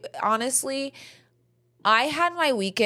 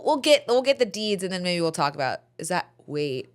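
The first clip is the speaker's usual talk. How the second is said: ordinary talk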